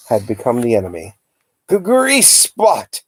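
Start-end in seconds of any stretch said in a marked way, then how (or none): 1.7-3.0 much higher, slow howl with chattering teeth. A narrator's reading. Uncle attacks the comic mirror, howling high. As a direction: howl at your own reflection. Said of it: "he howled".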